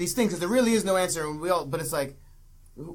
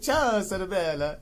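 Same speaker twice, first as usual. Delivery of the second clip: mock suave ciao; slower than usual. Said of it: "The way he says".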